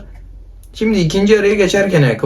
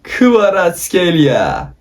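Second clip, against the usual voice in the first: slow, drawn-out announcer call; football commentator; 3 semitones higher, much slower than usual. Pretend. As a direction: start high and stretch the name as it falls. Spiker gibi oyuncu sunuyor. (uzata uzata spiker anonsu)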